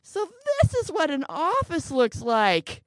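loud, high, brash; a brash shouted remark; shouting. A narrator's reading. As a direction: shout it across the room. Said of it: "She's still shouting".

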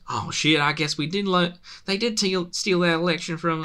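high-pitched mock Southern accent; Trump supporter accent. Another video watcher joining the stolen election panic, mock Southern Trump supporter accent. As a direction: play a dismayed believer in a put-on Southern accent. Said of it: "doing this accent as standard Trump supporters".

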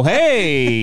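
high, drawn-out mock greeting; prank show reveal. Ashton jumping out on everyone, mock-cheery reveal. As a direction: call it out like a surprise host.

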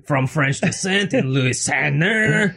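high, slow, lightly put-on Cajun accent; a hint of an offended Cajun, slowed and lifted; mock Cajun accent. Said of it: "a bunch of Cajuns out there".

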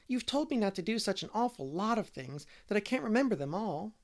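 put-on high-pitched whiny little boy; acted whimpering excuse. A narrator's reading. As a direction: protest in a child's plaintive whine. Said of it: "whimpered Davy".

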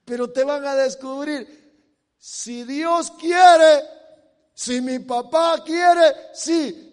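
loud, high, stretched-out proclamation; full-force preaching. Real, not acted. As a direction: shout the conviction from the pulpit.

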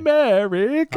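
high, hammed-up patriotic exclamation; mock patriotic cry. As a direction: proclaim it as a sarcastic punchline. Mock patriotic punchline after redneck joke.

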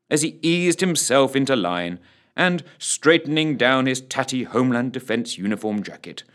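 usual speaking voice

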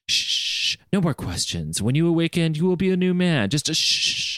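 a lightly put-on villain, hushing and soothing in a creepy way; mock-sinister doctor calming a patient. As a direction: shush him like a sinister surgeon. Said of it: "Sinister's like".

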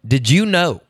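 a hint of official cop voice; police officer. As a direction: play an officer at the car window, pausing after "sir". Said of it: "Officer comes to the front. He goes".

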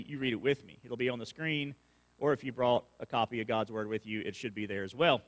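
ordinary talk, nothing marked

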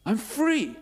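acted relief, pitched well up; elated. Forgiven debtor in parable rejoices at his cancelled debt, elated.

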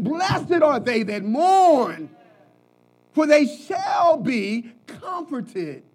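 very slow, drawn out, rising to a shout; solemn recital. Acted. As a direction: lay each word out slowly like holy words. Reciting scripture from the pulpit.

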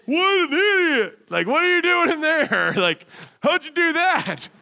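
high-pitched, hammed-up mocking voice; laughing ridicule. Coworkers making fun of the stuck kid, high mocking jeer. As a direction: jeer at him in a silly high voice. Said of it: "they started laughing at me".

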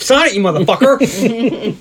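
hammed-up order with laughter close behind; impatient mock yell. O'Mara shaking the chair to get it signed, mock yell. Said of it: "so starts shaking his chair".

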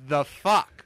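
loud Jamaican "The fuck" with a rising end; Jamaican accent. Retold or imitated. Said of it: "you gotta say".

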